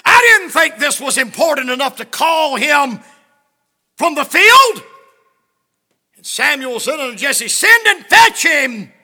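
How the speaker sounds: very loud, high, slow preacher's cry; preaching at full force. Acted. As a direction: stretch each word out to the church. Preached scripture and Jesse's excuse.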